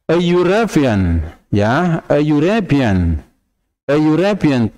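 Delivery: "European" starts with yu, so "a European"; yu sound. Shown as a demonstration. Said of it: "tidak an". ("a European" dengan bunyi yu)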